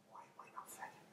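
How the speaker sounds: barely audible whispered thought; frozen, hushed panic. Acted. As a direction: whisper it, rooted to the spot. Frozen as fairies approach.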